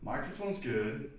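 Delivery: faint, distant voice off the mic; talking from across the room; far from mic. Real, not acted. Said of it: "it sounds like you're far away".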